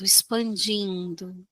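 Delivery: ordinary talk, nothing marked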